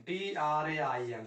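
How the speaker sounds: टी आर ए आई एन spelled out letter by letter, slowly; slow spelling. Shown as a demonstration. Teacher slowly spells train for the class. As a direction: space out टी आर ए आई एन one letter at a time. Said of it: "सोच के लिखते हैं train".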